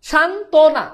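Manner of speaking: সান্ত্বনা split firmly as "shan, to-na"; teacher's emphatic sounding-out of সান্ত্বনা as "shan-tona"; syllable-by-syllable demo. Shown as a demonstration. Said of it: "সান্ত্বনা কিভাবে হয়েছে?".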